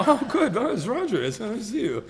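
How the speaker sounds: mock cheerful greeting. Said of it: "to my".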